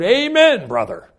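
high, loud cry of amen from the back pew; shouted amen. Retold or imitated. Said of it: "he could hear her shout".

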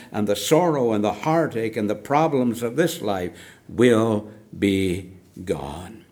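usual speaking voice

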